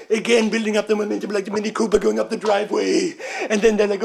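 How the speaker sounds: normal talking voice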